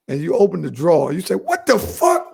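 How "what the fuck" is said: high, startled what the fuck; shocked outburst. Retold or imitated. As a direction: act out finding it in the drawer. Acted shock at finding dildo.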